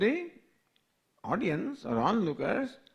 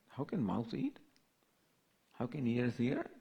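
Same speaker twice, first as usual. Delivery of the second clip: hushed, probing questions; soft questioning; 3 semitones lower, 7 dB quieter than usual. Acted. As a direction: keep the questions soft and searching. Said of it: "How can you say that?".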